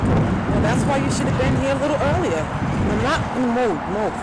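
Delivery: high-pitched, impatient telling-off; scold, then dismiss them; irritated reprimand. Real, not acted. Rejecting the excuse of snow and traffic, irritated reprimand. Scolding someone who came late.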